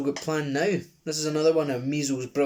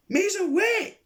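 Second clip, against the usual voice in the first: very high, loud calling shout; 5 dB louder, slower than usual. Acted. Man calls through the bars to the boy, loud shout. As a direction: call after him to wait. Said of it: "shouted Frank".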